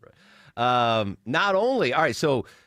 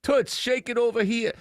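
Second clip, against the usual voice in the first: construction worker catcaller; 10 semitones higher than usual. Retold or imitated. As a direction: holler it like a street catcaller. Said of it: "I'm trying to do my dad as a construction worker cat caller".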